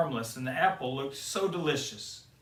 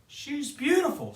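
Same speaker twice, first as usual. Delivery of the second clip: much higher, louder bashful dwarf; Bashful's voice; 13 semitones higher, 5 dB louder than usual. Acted. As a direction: gush in a high little voice.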